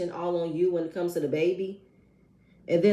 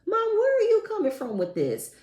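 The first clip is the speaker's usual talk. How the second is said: much higher, incredulous what the hell; shocked disbelief; 14 semitones higher than usual. Real, not acted. Reacting to the mom's claim, high shocked. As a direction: squeal your disbelief. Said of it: "I'm just like".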